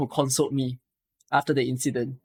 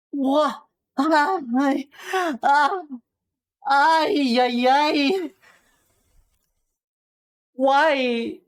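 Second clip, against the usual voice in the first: malaysian slang english; 11 semitones higher, much slower than usual. Real, not acted. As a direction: cry out in disbelief at chat. Shocked by chat comments.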